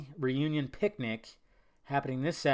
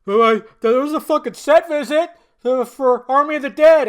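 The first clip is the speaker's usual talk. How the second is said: higher, louder gushing fan voice; mock hyped fan; 9 semitones higher, 12 dB louder than usual. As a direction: parody a breathless fan spilling news. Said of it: "there's a lot of people like".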